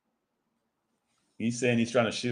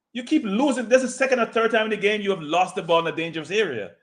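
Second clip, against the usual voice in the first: exasperated scolding; 8 semitones higher than usual. Real, not acted. Scolding the player.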